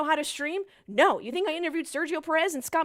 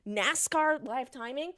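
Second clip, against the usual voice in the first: ordinary delivery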